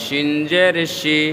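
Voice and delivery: 'শিন' and 'শি' with a full sh; thick SH. Shown as a demonstration. Teacher contrasts letters for learners, 'শি' with a thick sh. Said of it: "মোটা হবে".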